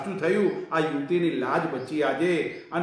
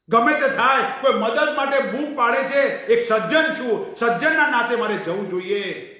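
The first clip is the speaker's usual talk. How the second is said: higher, louder resolute inner voice; firm resolve; 6 semitones higher, 5 dB louder than usual. Acted. Old man's heart urging him to go, resolutely. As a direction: declare it with conviction.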